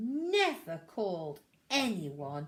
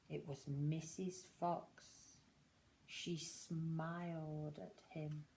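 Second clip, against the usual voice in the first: slow, softer tender telling; gentle narration; 4 semitones lower, 8 dB quieter, slower than usual. A narrator's reading. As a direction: ease down gently and slowly. Gentle narration of Mrs Fox.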